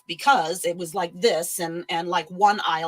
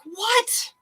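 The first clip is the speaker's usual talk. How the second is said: high, baffled squeak of disbelief; imitating her own shocked reaction; stunned what; 14 semitones higher than usual.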